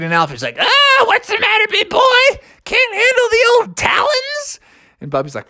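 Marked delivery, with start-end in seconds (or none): 0.6-4.6 very high, taunting owl voice; teasing owl. A narrator's reading. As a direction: tease like a zany old bird. Owl teases the bear.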